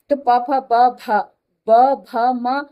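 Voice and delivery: ordinary talk, nothing marked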